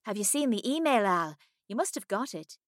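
a hint of an eager friend; Millie. Millie voiced in dialogue.

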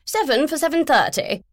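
brisk and grand; posh woman. Acted. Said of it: "Philomena went on".

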